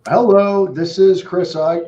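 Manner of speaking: high, loud sales hello; bright cold call opener; phone greeting voice. Real, not acted.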